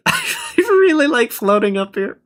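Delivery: high playful voice with laughs; giggly high voice. Real, not acted.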